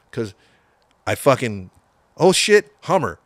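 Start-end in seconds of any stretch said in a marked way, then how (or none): 2.2-3.2 much higher and louder, alarmed; panicked exclamation. Retold or imitated. Seeing the Hummer ahead, a sudden panicked shout.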